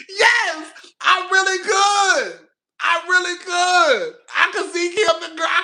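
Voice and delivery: high, loud and slow through laughter; almost in tears from laughing; laugh-crying. Real, not acted. Laughing so hard at the comment, high and loud.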